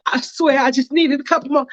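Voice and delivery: a scared man pleading over money; pleading debtor. Acted. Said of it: "And he's like".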